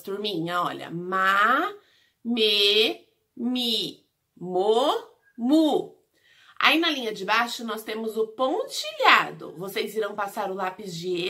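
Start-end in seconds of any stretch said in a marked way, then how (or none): 0.9-6.0 "Ma, me, mi, mo, mu" as separate drawn-out syllables; syllable drill. Shown as a demonstration. Teacher reciting the syllables of letter M, "Ma, me, mi, mo, mu" one by one. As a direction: stretch and separate "Ma, me, mi, mo, mu". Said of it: "aqui em cima nós temos as sílabas".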